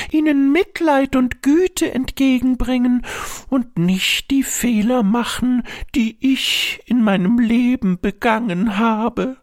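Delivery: put-on high, fond old mother voice; a proud, gentle old mother. A narrator's reading. Dead mother praises her son.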